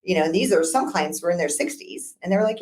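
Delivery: usual speaking voice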